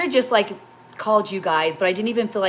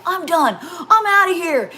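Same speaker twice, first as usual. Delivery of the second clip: high, loud acted outburst; exasperated quitting; 10 semitones higher, 6 dB louder, slower than usual. Retelling how she gave up after a trying day, exasperated. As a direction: throw up the pitch, stretching the end.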